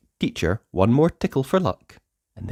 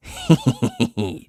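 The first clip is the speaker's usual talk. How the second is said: giggle; 5 semitones higher than usual. A narrator's reading. Narrator laughs as tickle lands.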